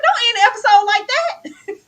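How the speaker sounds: high, loud, laughing protest; mock outraged plea. Viewer pleading with the show not to end, mock outrage. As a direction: beg loudly in comic disbelief.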